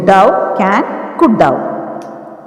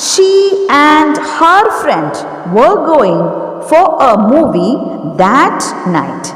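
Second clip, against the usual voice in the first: dictation; 5 semitones higher, much slower than usual. Shown as a demonstration. Teacher dictates the model answer.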